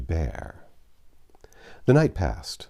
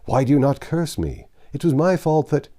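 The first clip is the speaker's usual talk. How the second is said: raised, pleading cry to his master; 8 semitones higher, 6 dB louder than usual. A narrator's reading. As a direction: call out in anguish, higher and louder. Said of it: "he cried".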